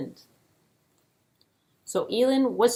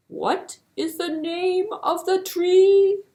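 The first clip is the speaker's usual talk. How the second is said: raised, pleading tortoise question; higher tortoise ask; 11 semitones higher, 6 dB louder, slower than usual. Acted. Tortoise asking the king a third time, pitch raised.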